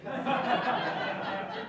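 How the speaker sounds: breathy chuckle after punchline; laughing at own joke. Real, not acted. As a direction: crack up at your own joke.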